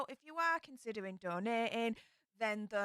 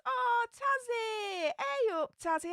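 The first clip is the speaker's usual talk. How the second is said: high, delighted shout of a friend's name; excited greeting; 13 semitones higher, slower than usual. Real, not acted. Streamer spots a regular viewer in chat, delighted high shout.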